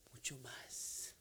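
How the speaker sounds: slow, voiceless whisper. Acted. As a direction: draw it out in a hushed whisper. Preacher whispers after the crying out.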